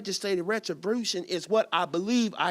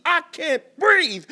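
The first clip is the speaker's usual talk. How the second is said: slow, high, loud cry; shout; 8 semitones higher, 8 dB louder than usual. Acted. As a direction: push the refrain out hard. Poem climax, refrain shouted.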